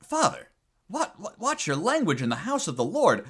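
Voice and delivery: high-pitched pearl-clutching gasp at "Father"; scandalized nun. Acted. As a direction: play a shocked nun, stammering into a scolding. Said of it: "clutches her, her pearls".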